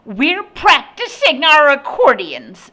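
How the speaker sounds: high, loud indignant woman; shrill woman's voice. A narrator's reading. Mother to the complaining neighbor, shrill and indignant. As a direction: retort loudly in a high voice.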